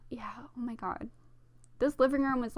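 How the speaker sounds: ordinary talk, nothing marked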